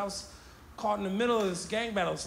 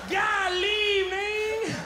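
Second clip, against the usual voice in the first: Texan teacher; 10 semitones higher, much slower than usual. Retold or imitated. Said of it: "And that was my teacher".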